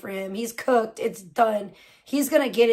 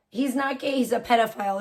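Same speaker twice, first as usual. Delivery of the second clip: usual speaking voice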